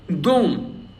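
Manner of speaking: "dom" with a full, stressed O. Shown as a demonstration. Teacher showing learners a stressed vowel, stressed O in "dom". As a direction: say "dom" with a strong, clear O. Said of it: "so that is stressed".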